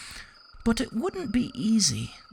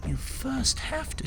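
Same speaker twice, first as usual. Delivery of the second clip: ordinary delivery, nothing marked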